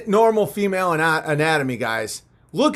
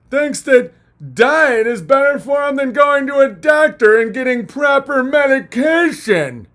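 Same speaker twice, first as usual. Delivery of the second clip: higher, louder sneering critic, half shouted; mocking critic rant; 6 semitones higher, 4 dB louder than usual. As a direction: ham up the outraged critic. Mocking critics, loud high.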